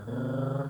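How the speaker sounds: short throaty gargle; brief uvular trill; gargled R. Shown as a demonstration. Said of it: "Fazendo um gargarejo".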